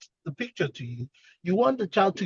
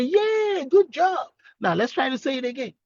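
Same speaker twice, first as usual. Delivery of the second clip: acted delight, pitched way up; encouraging praise; 8 semitones higher than usual. Therapist praising a child's first try, acted high-pitched encouragement.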